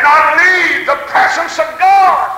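high, loud, slow climax; fervent preaching cry. Real, not acted. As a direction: stretch it out in a high preacher's shout.

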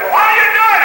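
high, very loud challenge; imitated shouting demand; shouted retold question. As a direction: holler it as the indignant neighbor. Communist questions the praying preacher.

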